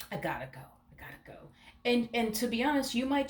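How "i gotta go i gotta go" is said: quieter, trailing into a breathy whisper; soft mock whisper of leaving; fading to a whisper. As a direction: let it die away to a whisper. Quoting the partner, fading away.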